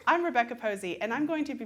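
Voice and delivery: bright-pitched, loud audition greeting; confident introduction. Shown as a demonstration. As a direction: project a hearty hello to the panel. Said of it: "you want to say".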